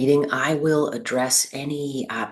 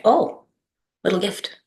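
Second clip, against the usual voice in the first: high, delighted little "oh" of discovery; suddenly higher, pleased surprise; pleasant surprise; 8 semitones higher than usual. Acted. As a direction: react as if finding a small unexpected gift. Acts out finding money in pocket.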